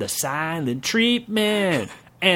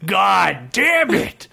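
yelling; 5 semitones higher, much slower than usual. Acted. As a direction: bellow it in a rage. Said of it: "yelled the king".

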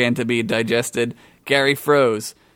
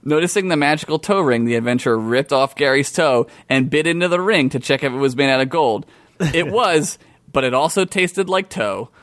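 plain reading voice

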